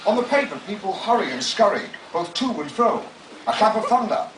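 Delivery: grandly narrated, savouring each phrase; dramatic book reading. Acted. Author reading his memoir to an audience, pompously. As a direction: read it like a pompous audiobook.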